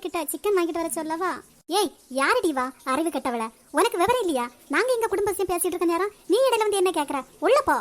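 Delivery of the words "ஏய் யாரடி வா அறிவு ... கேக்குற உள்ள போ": sharp scolding, rising in pitch; angry scolding. Acted. Elder scolds the maid for interrupting family talk, angry.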